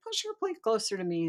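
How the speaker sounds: demanding frog. Acted. Frog at dinner demands the princess's plate, high and insistent. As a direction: order her about as a little frog.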